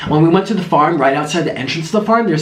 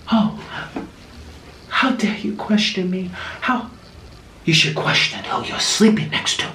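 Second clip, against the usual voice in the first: indignant hushed retort; 6 dB quieter than usual. Retold or imitated. Lady deflects theft accusation.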